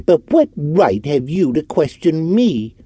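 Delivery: high indignant sneer. Acted. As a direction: throw the question back with scorn. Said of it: "answered Orton with a sneer".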